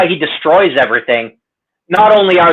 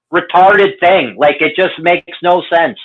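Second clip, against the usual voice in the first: ordinary delivery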